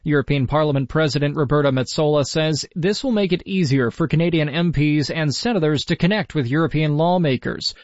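normal talking voice

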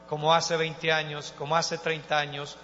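usual speaking voice

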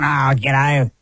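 low mock smoker's voice; chain-smoking grandmother. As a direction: play a grandmother buying her cigarettes. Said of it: "She was going".

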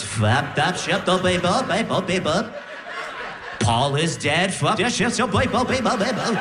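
loop it like a record played backwards; mock stuttering sleep babble; backwards record gibberish. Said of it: "Sounds like you're turning a record backwards".

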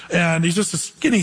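ordinary delivery

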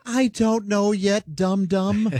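high, slow put-on silly voice; mock cheerful. Josh asked if he'll be cheerful, mock-cheery high voice.

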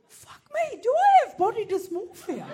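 high, loud shocked squeal. Retold or imitated. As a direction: shriek it in mock alarm. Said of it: "And I'm like".

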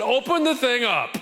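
a hint of a high, commanding Jesus; lightly voiced Jesus giving orders.

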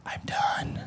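hushed, voiceless declaration; acted exhausted near-whisper; imitated spent wife. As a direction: say it under the breath, used up. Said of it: "my wife will be like".

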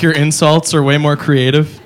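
ordinary delivery

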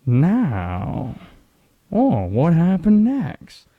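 lightly voiced dramatic TV host, slowed; Keith Morrison impression. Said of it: "When Bill Hader's playing Keith Morrison".

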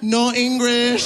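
high, slow feigned broken English. Retold or imitated. Comedian copying grandma to dodge passengers, high pretend broken English. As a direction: pretend not to speak English, high and drawn out. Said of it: "before saying".

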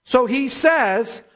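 usual speaking voice

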